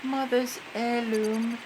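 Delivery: put-on high, slow child's voice; little kid voice. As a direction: say it like a small child. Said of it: "a little kid version".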